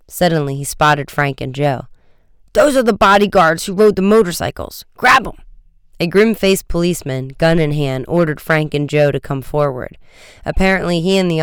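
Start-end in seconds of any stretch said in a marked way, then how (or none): 2.5-5.4 loud, higher-pitched alarm; agitated shouted order; excited accusation. A narrator's reading. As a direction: accuse them and urge their capture. Guard accuses and orders seizure.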